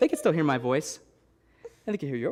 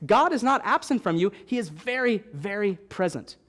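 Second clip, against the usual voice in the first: raised, emphatic preaching voice; preacher lifting the crowd; 4 semitones higher than usual. Acted. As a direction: proclaim it to the congregation with force.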